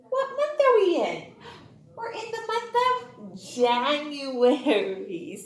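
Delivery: usual speaking voice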